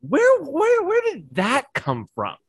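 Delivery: very high-pitched, drawn-out disbelief; retold astonishment. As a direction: act out being completely baffled, slowing down. Retold astonished reaction to child's words.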